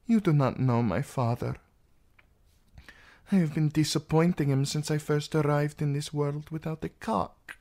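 lightly voiced resentful princess; embittered princess. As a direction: recount a father's slights with mild bitterness. Princess complains about her father.

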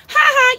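high taunting "ha ha"; high playground jeer; mocking ha ha. Real, not acted. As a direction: jeer at him with a high laugh. Mocking laugh at chatter.

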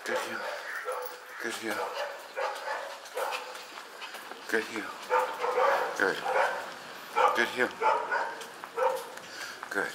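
soft, brief praise; calm cueing. Real, not acted. Trainer walking dog at heel, soft and calm. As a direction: keep it quiet and even.

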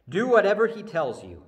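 loud, firm motherly order; Mary's bossy instruction, loud; firm command. Acted. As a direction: order the servants like a determined mother. Preacher voicing Mary to servants.

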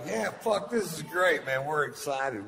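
high, giddy thrill; excited. Retold or imitated. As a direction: gush with delight like a kid. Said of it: "So I was like".

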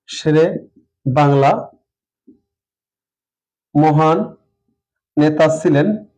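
শেরে বাংলা মহান নেতা ছিলেন, each word on its own with long gaps; slow dictation. Shown as a demonstration. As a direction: dictate it word by word while writing. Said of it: "আমি যদি একটা বাক্য লিখি এভাবে". (ধীরে ধীরে শব্দ ধরে ধরে বলা)

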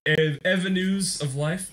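ordinary talk

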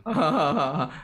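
short burst of open laughter; amused, spontaneous laugh; real laugh. Real, not acted. Co-host laughs after handover.

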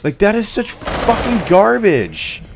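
loud, slow, high angry shout at the game; rage-yell each word at the screen; real gamer rage, drawn-out and loud; furious yelling. Real, not acted. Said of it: "this is making me really fucking angry".

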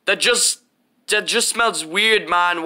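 usual speaking voice

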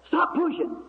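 high-pitched mock yell; high, imitated telling-off; imitated crowd heckle. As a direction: call out like an annoyed stranger. Irritated stranger yells in crowd.

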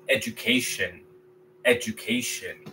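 education quietly, ed-u-kay-shun; English pronunciation. Shown as a demonstration. Teacher modeling English for students, quietly. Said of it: "En inglés".

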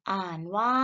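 usual speaking voice

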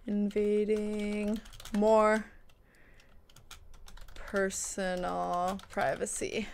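very slow, stretched-out words, softly; dictating while typing. Real, not acted. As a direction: sound out each word slowly as you type it.

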